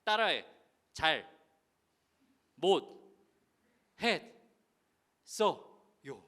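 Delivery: loud, each syllable shouted apart; syllable-by-syllable demand. Retold or imitated. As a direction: drill it out one syllable at a time. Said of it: "이 짓거리를 했는데". (한 글자씩 끊어 외치는 호통)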